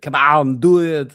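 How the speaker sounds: pumped-up movie muscleman selling a product; Arnold Schwarzenegger. Retold or imitated. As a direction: bark it like an action star in an ad. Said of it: "You can imagine Arnold Schwarzenegger popping up with that advert".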